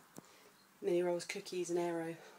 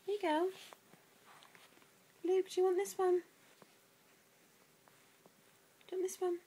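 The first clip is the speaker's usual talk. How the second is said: very high cooing questions; 12 semitones higher, faster than usual. Real, not acted. Mum offering baby a toy, high baby talk.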